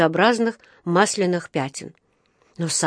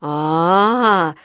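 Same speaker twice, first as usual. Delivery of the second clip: mocking, drawn-out scoff, louder at the end; с усмешкой; 6 dB louder, much slower than usual. A narrator's reading. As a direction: scoff with a hint of a chuckle. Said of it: "хохотнул вахтёр". (насмешливо, нараспев)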